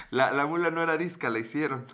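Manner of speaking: talking through real laughter; words bubbling out of a laugh; chuckling while telling the punchline. Real, not acted. Laughing at own joke.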